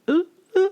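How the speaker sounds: very high, short nervous whimpers; imitated fearful squeak. Imitating Benjamin's sound before the affair, frightened high squeaks. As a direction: mimic fear leaking out in little high noises. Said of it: "so much fear is just releasing".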